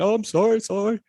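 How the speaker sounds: high-pitched pleading apology, retold; mock crying. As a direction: imitate a scared kid tearfully saying sorry. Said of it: "and I'm crying".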